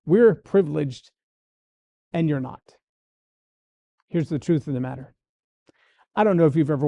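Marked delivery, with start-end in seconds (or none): 0.1-2.6 smug attitude. Retold or imitated. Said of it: "with an attitude that says".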